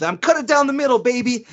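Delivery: high-pitched catchphrase impression; Bill Alfonso. Fan quoting a wrestler to his face, high-pitched impression. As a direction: quote the catchphrase high and punchy. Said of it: "me and him quote you all the time".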